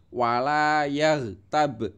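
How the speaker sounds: wala yaghtab slow, the b bounced at the end; teacher demonstrating qalqalah; bouncing b. Shown as a demonstration. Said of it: "Baknya itu mantul ya".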